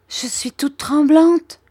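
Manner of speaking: high, loud, distressed voice; distressed stammer. A narrator's reading. Trembling mother stammers to Miss Betsy, distressed. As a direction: cry it out in agitation. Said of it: "balbutia ma mère".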